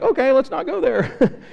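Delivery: high, timid, laughing reply; squeaky nervous agreement; meek giving in. Retold or imitated. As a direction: give in meekly to the big guy. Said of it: "I'll be like".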